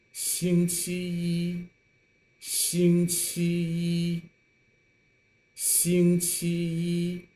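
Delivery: 星期一 with 一 held level, slow; 星期一 drawn out, the 一 level and high; first tone yī. Shown as a demonstration. Teacher drills the class on level yī, slow. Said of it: "it pronounced as the first tone".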